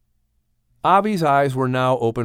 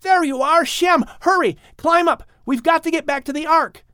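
much higher, urgent shout; shouting urgently; 14 semitones higher than usual. Acted. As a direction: hurry your brother aboard. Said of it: "Ham shouted".

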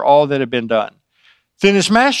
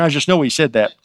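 usual speaking voice

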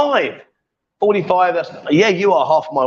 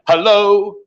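louder, long-held demo hello; 3 semitones higher, 3 dB louder than usual. Shown as a demonstration. Coach showing Linda how to say hello, loud. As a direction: call out a hello, drawn out. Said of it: "gotta speak loud".

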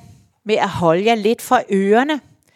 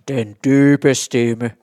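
showing what a deep voice sounds like, slow; deep voice demo; 7 semitones lower, much slower than usual. Shown as a demonstration. Teacher showing pupils the bass voice, low and slow. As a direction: drop the voice low and slow on dybe. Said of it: "Mange kor er delt i tre eller fire stemmer".

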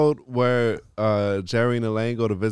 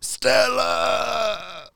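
calling out, rising to a shout; loopy Stella call; 8 semitones higher, much slower than usual. Retold or imitated. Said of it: "Elaine's like".

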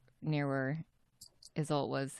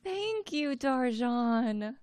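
squeaky Winley voice; 8 semitones higher, much slower than usual. Acted. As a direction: call out to Izzy in a tiny shrill pitch. Winley voice again.

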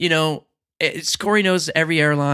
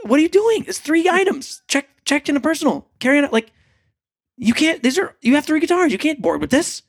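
high scolding agent; 10 semitones higher than usual. Retold or imitated. Gate agent scolding, retold.

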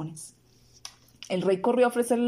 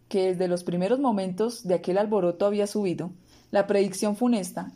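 usual speaking voice

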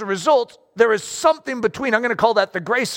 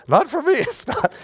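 higher-pitched laughing objection; joking, chuckled disclaimer; amused protest; 5 semitones higher than usual. Acted. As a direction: laugh through a mock protest. Jokingly voicing reluctant listeners, laughing.

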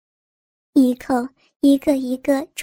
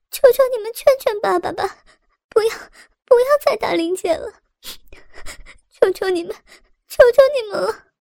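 high, fast, desperate begging; crying out; 6 semitones higher, faster than usual. A narrator's reading. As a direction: call out to the elders, higher and more frantic. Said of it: "喊道".

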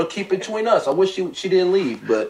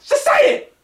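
much higher, hammed-up plea; mock plea. Pleading with his absent girlfriend, hammed-up wail. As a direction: fake a wounded plea. Mock distress over thrown shirts.

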